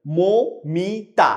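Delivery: Moumita stretched very slowly; drawn out slowly. Shown as a demonstration. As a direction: pull Moumita out syllable by syllable. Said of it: "একটু confused হয়ে যায়". (ধীরে টেনে মৌমিতা)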